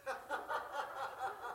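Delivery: quick airy chuckle; soft real laugh; breathy laugh. Real, not acted. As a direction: laugh at your own joke. Laugh after joke.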